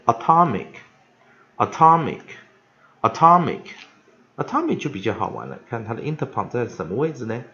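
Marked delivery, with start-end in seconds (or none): 0.0-3.6 "atomic" said slowly, loud stressed middle syllable; careful, spaced-out repeats of "atomic"; pronunciation demo. Shown as a demonstration. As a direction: model "atomic" for learners, punching TOM. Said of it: "我们现在看下个单字".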